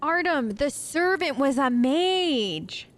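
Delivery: long drawn-out oh of discovery; dawning realisation. Real, not acted. Streamer working out the servant's secret, slow dawning surprise. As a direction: stretch it out as the secret clicks. Realisation after reading the journal.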